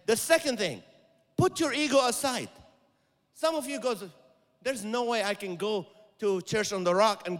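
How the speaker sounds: loud, high pastor's charge with a mock proud quote; preaching hard. Preaching at full force.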